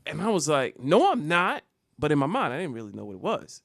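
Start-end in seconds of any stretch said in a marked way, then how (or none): 0.8-1.6 mildly raised, louder comeback; defensive denial. Retold or imitated. Schoolboy answering a classmate's taunt, defensive denial. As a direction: push back at the taunt.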